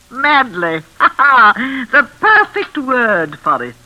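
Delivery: laughing, then savouring the words slowly; mad laughter. Acted. The mother mocking her son's madness, laughing slowly. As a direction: relish the word with a cruel laugh.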